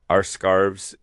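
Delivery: ordinary delivery, nothing marked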